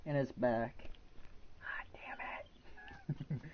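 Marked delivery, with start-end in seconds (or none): 1.6-3.4 "God damn it" breathed out under his breath; annoyed whisper. Real, not acted. Gamer cursing the fly buzzing round his room, whispered.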